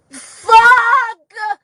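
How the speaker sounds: loud anguished curse; despairing shout. Real, not acted. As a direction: yell it out in despair. Outburst in despair.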